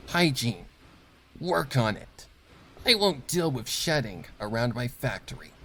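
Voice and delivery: curt, cold orders to a new worker; clipped commands, looking down on him; stern boss mare. Acted. Factory boss inspecting a new worker, cold and curt.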